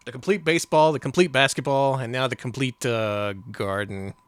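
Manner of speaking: ordinary talk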